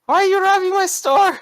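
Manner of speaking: very high-pitched, indignant store owner; accusing shop owner. Acted. Store owner confronts the robbers, high-pitched accusing. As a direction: squeal the accusation in a high character voice. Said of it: "As he's accusing you".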